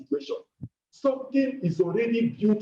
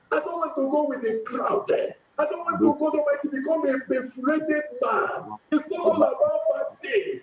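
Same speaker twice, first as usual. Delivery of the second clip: impassioned prayer-meeting cry; pleading preacher's prayer; fervent prayer; 6 semitones higher than usual. Acted. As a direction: lead the room in pleading prayer. Leads congregation in fervent prayer.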